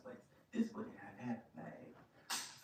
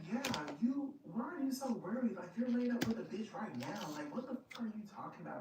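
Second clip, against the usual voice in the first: sassy retort; 7 semitones higher, faster than usual. Retold or imitated. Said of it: "then I'm like".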